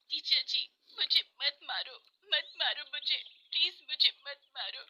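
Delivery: put-on high, frightened little girl's plea; acted tearful pleading. Kosi begs the teacher to stop beating her, pleading. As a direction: beg for mercy. Girl begs not to be beaten.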